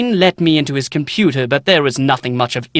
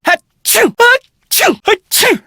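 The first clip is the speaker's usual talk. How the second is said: three loud high sneezes in a row; sneezing fit; 6 dB louder, slower than usual. Acted. Librarian caught in a sneezing fit, loud high sneezes. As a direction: sneeze hard, over and over.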